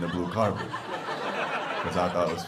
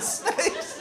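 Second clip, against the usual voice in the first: high mock snakes. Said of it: "he said".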